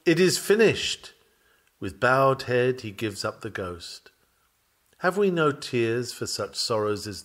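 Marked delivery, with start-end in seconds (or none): 0.0-1.1 a mild raised cry, a touch higher; a lifted proclamation, lightly voiced; shouting. A narrator's reading. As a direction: call it out with the last of his strength, not a full shout.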